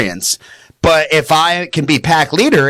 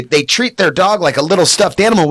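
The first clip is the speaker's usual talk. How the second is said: normal talking voice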